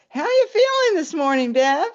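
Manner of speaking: high, loud, pointed morning greeting; teasing greeting. Retold or imitated. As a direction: needle her with exaggerated loud cheer. Roommates tease her next morning.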